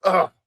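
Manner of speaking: loud, exasperated "ugh"; frustrated groan. Retold or imitated. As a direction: let out an angry groan, imitating a fed-up mother. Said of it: "just completely frustrated and angry".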